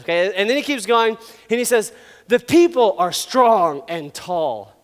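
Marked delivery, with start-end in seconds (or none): 2.3-4.7 very slow, drawn-out and ominous; fearsome announcement. Acted. Moses warning his people about giants, slow and ominous. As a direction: stretch each word portentously. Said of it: "This would make fear in their heart".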